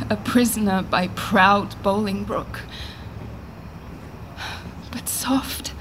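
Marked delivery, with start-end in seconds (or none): 4.9-5.7 whispered aside. Acted. Queen waiting for fallen husband.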